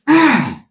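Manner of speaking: high, loud grunt of impact. Retold or imitated. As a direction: grunt as if hit hard. Grunt on the hit.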